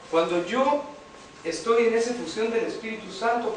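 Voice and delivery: normal talking voice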